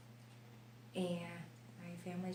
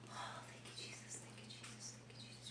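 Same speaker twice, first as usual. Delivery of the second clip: breathless whispered prayer of relief; a real whispered prayer after the pain, thanking Jesus; whispered thanks; 12 dB quieter than usual. Real, not acted. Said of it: "Just like I'm doing right now".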